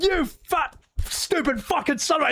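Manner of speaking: high mock furious tirade; imitating Sid cursing. Sid insulting Palmer, a high hammed-up cursing rant. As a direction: spit insults at him. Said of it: "He's like".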